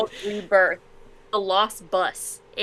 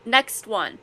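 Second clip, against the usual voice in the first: ordinary talk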